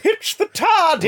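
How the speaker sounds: high, slow excited gasp; mock companion voice. Host hamming up a companion spotting the TARDIS. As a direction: squeal it like a startled companion. Doctor Who character voice bit.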